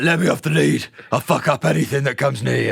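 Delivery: a dog getting a bit pissed off; lightly voiced angry dog. Dog to owner about the cows, mock pissed off. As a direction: speak for a dog straining to have a go. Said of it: "getting a bit pissed off".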